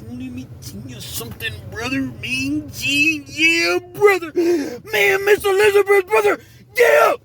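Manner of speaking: very high, strained wrestler promo voice; the Hulkster. Retold or imitated. Storyteller showing how Big Will talked, as a wrestler's high shouted promo. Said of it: "He talked like the Hulkster".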